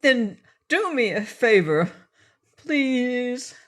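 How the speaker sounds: a light beg; begging. Acted. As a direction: plead for a small favor. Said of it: "begged Pessum".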